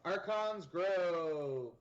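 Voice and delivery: loud, very drawn-out place name; grand announcement. Pretend. Player announcing a game location to a friend, grandly.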